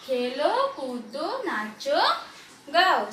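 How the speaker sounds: one word at a time, endings lifted; bit by bit. Shown as a demonstration. Teacher shows children reading bit by bit. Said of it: "इस तरह से आप थोड़ा थोड़ा करके पढ़ो".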